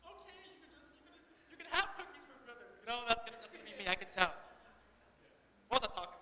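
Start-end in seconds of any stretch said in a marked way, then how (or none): 0.1-2.7 high, very soft indulgent voice; soft-touch dad. Pretend. Dad secretly indulging his daughter, mock soft giving in. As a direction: give in sweetly, small and high. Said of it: "but me, I'm like".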